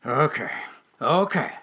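reluctant giving in, fairly loud; grudging mutter. Acted. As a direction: give in with a grumble. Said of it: "he muttered".

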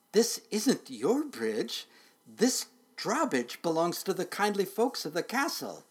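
high, halting nervous objection; protesting driver. A narrator's reading. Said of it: "stuttered the driver".

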